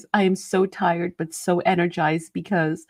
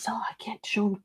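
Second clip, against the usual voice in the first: secretive whisper; 3 semitones higher, 9 dB quieter than usual. Real, not acted. Teasing unreleased colors.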